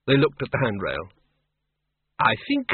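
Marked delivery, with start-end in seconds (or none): 2.2-2.7 very high-pitched nervous voice. A narrator's reading. Doreen deciding on the stairs, high and nervous. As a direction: pitch it right up, hesitant and uneasy. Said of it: "said Doreen nervously".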